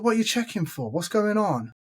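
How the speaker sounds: high-pitched, puzzled protest; indignant questioning. Retold or imitated. Traveller challenging the man searching his suitcase, indignant. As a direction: question it in mild disbelief.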